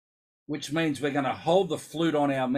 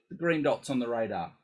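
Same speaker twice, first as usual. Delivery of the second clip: ordinary talk, nothing marked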